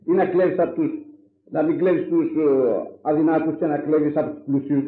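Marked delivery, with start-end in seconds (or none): none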